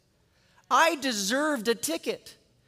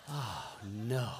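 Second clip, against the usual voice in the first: very low, hushed mock dread; sinking dismay; 13 semitones lower, 11 dB quieter than usual. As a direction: let it sink to a near mumble, as if doomed. Reacting to second expired card.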